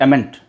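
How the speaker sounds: amn't as two syllables, "em-ent"; common wrong form. Shown as a demonstration. English teacher quoting learners' mistake, two-syllable amn't. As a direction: say amn't with a full second vowel. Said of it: "कुछ लोग बोलते हैं".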